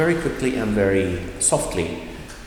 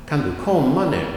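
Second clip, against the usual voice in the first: quick "kan du", emphasized "komma", light "nu"; stress on komma; 5 semitones higher, faster than usual. Shown as a demonstration. Teacher giving an example question, stressing main verb "komma". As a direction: run through "kan du" and lean on "komma". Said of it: "that is emphasized".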